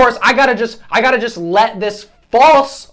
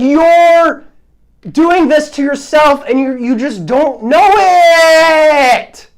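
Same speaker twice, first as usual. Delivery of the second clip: high, exasperated outburst with drawn-out words; fired-up scolding wail; heated; 5 semitones higher, 4 dB louder, much slower than usual. Real, not acted. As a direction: stretch the last words into a frustrated yell. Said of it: "Got a little heated there".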